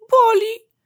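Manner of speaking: loud, very high wail of pain; high childish pained yell; child crying out. A narrator's reading. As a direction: shout it as a hurting toddler. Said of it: "zawołał maluch".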